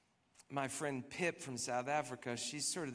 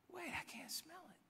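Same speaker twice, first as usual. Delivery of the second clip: whispered, higher, softer; surprised whisper; 14 semitones higher, 12 dB quieter than usual. Retold or imitated. To Cassie in the garden room, a startled whisper. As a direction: breathe it out in sudden disbelief.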